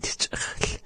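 breathy, voiceless "ch-" from the throat; raspy back CH. Shown as a demonstration. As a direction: rasp "ch-" at the back of the mouth. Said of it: "sort of a raspy sound".

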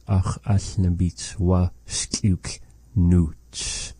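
Ach as nabits wa skliuk nuts said at half speed, word by word; drawn out, word by word; very slow. Retold or imitated. Teacher quotes the speaker Simdi Sam Nelson very slowly. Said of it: "Simdi Sam Nelson".

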